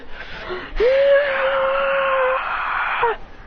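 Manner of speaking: long, high sustained scream; strained high power-up yell; acted giant's scream. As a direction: scream as a universe-sized fighter.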